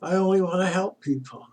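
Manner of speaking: a lightly voiced humble hermit, pleading. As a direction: beg the famous teacher to help. Hermit begs pundit for help.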